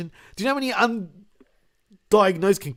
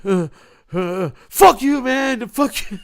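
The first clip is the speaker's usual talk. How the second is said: high, drawn-out mock outburst; halting grunts bursting into a high-pitched protest; frustrated struggling reader; 7 semitones higher, 5 dB louder, slower than usual. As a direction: act out a flustered man cursing. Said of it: "he'd be like".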